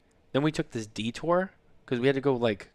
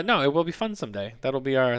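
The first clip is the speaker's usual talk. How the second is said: usual speaking voice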